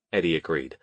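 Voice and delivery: ordinary delivery, nothing marked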